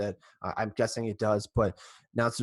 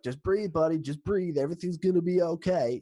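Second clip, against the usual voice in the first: higher, hammed-up folksy accent, Chicago meets Farmville; David Ross impression; 6 semitones higher than usual. Ross calming Rizzo in the dugout, higher hammed-up folksy accent. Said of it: "that's my best David Ross for you".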